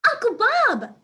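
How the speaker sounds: high, loud puppy call; little dog shouting a greeting; excited call. A narrator's reading. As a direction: call out gleefully across the yard. Ruby calls out, galloping over.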